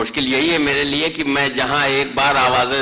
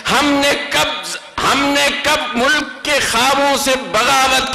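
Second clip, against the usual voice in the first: rising, declamatory mushaira recitation; stately nazm recitation; 7 semitones higher, slower than usual. Acted. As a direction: build each line higher, then land the refrain. Poet recites nazm stanza. (پرجوش مشاعرے کی نظم خوانی)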